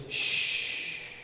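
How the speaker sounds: unvoiced shh; hushing shh. Shown as a demonstration. Preacher showing how to stop giving advice, soft shh. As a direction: breathe a soft hush. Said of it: "the best thing is just".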